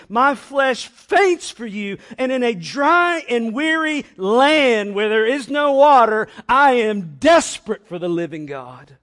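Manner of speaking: full-force impassioned psalm reading; desperate intensity. Shown as a demonstration. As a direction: cry it out as a desperate plea. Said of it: "intensity and desperation for God".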